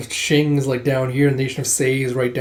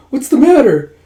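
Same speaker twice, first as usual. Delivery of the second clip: high, loud mock alarm; acted startled fussing; alarmed concern; 9 semitones higher, 8 dB louder than usual. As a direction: ask in startled worry. Acted alarm at sudden tears.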